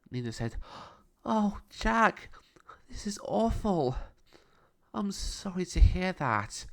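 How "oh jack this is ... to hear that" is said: put-on much higher, slower girlish sympathy; Nina's voice. A narrator's reading. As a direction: Nina comforting Jack about his mum.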